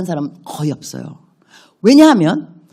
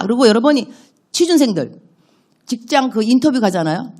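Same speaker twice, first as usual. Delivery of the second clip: ordinary delivery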